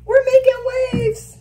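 very high sing-song cheer; high-pitched celebration; excited celebrating. Real, not acted. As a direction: chant it high like a little victory. Celebrating progress while getting ready.

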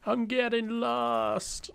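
stretched high wail of frustration; high drawn-out whine. Real, not acted. Repeats lost complaint, high slow.

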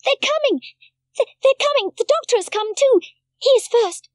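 high, loud, breathless running call; excited calling. A narrator's reading. Heidi runs shouting that the visitors are coming, high and loud. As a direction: shout the news while running. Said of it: "calling as she went".

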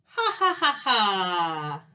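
mock scheming ha-ha-ha; stagey gloating laugh, last note drawn down. Skunk gloats over his trap.

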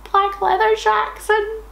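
high, disbelieving repeat; incredulous question. Real, not acted. Host rereads the fic's typo, high and incredulous. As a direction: leap up in mock disbelief.

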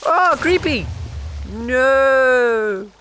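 startled high squeal; panicked scream. Real, not acted. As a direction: shriek at the creeper. Creeper appears, panics.

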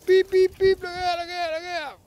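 squeaky pretend car horn; mock warning. Owner jokes as dog speeds past, squeaky horn warning.

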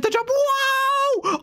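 long, high squeal of amazement; high-pitched whoop of wonder; airborne thrill. Real, not acted. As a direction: cry out as you go flying. Car flies off the jump.

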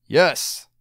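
high, loud king's shout; yelled yes. A narrator's reading. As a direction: shout it across the courtyard. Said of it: "so he yelled".

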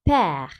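"père" with an open, lengthened è. Shown as a demonstration. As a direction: say "père" opening the E wide. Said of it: "E'yi açıyoruz artık". ("père" açık ve uzun E ile)

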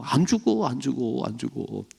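high coaxing then fading; a hint of a sly tempter, dying away; tempting devil. Preacher voicing the devil whispering to Eve, coaxing.

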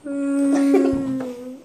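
stretched straining hum; slow-motion swing noise. Retold or imitated. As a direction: imitate a punch coming in slow motion. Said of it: "like, so slow".

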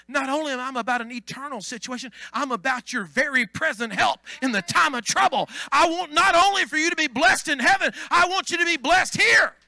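rising to a loud preaching shout. Acted. Preacher to congregation, building to a shout.

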